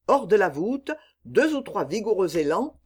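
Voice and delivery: ordinary delivery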